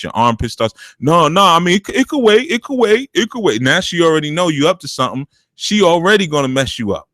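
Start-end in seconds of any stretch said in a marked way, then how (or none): 1.0-3.6 higher, flustered backpedal; a son losing his nerve; nervous son backing off. Retold or imitated. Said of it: "that's when you start getting nervous".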